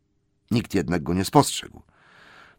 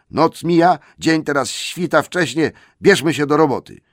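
much higher, louder urging; urgent troll; 8 semitones higher, 7 dB louder than usual. Acted. Tom and Bert loudly urge getting to work. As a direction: hurry them up loudly.

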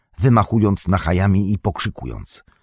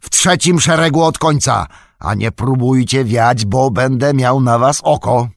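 loud, raised bullying warning; bellowed order; 5 semitones higher, 5 dB louder than usual. A narrator's reading. Orc driver placing two stragglers under watch, bellowing. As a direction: bellow the command, then keep threatening loudly. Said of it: "huknął poganiacz".

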